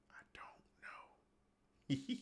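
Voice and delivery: slow, breathy whisper to himself; slow whispered shrug; whispered aside. Real, not acted. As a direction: mutter it under your breath, unsure. Unsure aside while testing playback.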